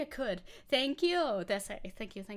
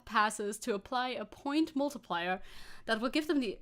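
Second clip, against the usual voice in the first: ordinary talk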